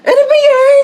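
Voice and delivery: very high, loud kilig squeal; high excited shriek; giddy squeal. Acted. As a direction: squeal over a crush. Giddy at crush.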